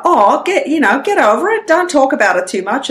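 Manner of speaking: high, breezy Australian brush-off; Aussie accent. Retold or imitated. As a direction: imitate an Aussie waving the matter away. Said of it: "they have that Aussie".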